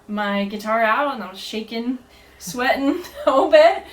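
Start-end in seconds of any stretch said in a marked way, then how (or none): 2.4-3.8 laughing. Real, not acted. Recalling stage nerves.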